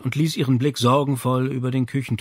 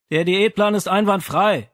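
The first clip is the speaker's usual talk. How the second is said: louder call back down; 7 semitones higher, 5 dB louder than usual. Acted. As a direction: shout it back down the stairs. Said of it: "rief Rezi zurück".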